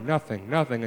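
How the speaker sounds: ordinary talk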